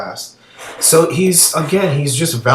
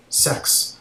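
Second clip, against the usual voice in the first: whispered; 5 dB quieter than usual. Pretend. Mocking his flip to sex.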